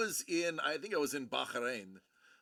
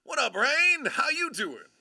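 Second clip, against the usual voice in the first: high, loud shout-out; big, punchy welcome; excited greeting; 10 semitones higher, 8 dB louder than usual. Real, not acted. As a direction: holler hello to a newcomer. Greeting an arriving viewer.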